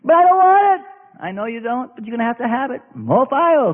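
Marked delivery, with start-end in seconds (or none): 0.0-0.9 high, whiny pleading voice; high whining plea to the Lord; impatient believer. Acted. 1.2-2.8 much softer, calm reply; soft calm divine answer; gentle God. Acted.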